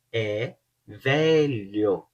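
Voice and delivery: "é velho" very slow, the LH in "velho" drawn out; slow lh demo. Shown as a demonstration. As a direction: stretch "velho" to show the LH sound. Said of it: "o L H é um pouco difícil". ("é velho" bem devagar, mostrando o LH)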